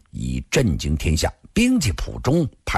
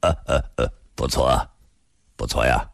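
low-pitched, understated chuckle; faint laugh; 7 semitones lower, faster than usual. A narrator's reading. Middle-aged man answering Shangguan, faint low laugh. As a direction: chuckle lightly, then concede in a low voice. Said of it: "突然淡淡一笑".

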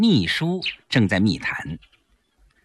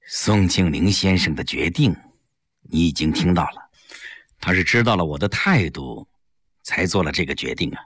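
put-on lower, measured old leader's voice; elderly statesman; 8 semitones lower than usual. Li Jishen confiding to Yang Jie, low and measured. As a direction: speak like a senior statesman confiding.

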